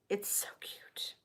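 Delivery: whispered to herself. Real, not acted. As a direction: breathe it out as a fond aside. Fond aside over tiny cuff.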